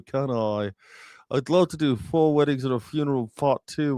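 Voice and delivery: mock Hugh Grant, mouth pulled down; Hugh Grant impression. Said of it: "Hugh Grant always talks like this".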